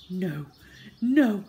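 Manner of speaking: acted gasps between words; gasping. Boy feigning illness to the nurse, gasping. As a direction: gasp it out as if ill. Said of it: "gasped Henry".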